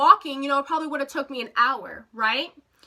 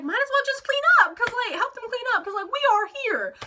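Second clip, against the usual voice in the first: higher-pitched, insistent retold thought; urgent inner voice; 8 semitones higher, faster than usual. Guest watching hosts clean up, high insistent urging. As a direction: pitch it up, pleading with herself.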